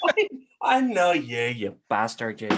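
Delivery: drawn-out, mock-angry Northern Irish threat; Northern Irish accent. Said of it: "he's, like, Northern Irish".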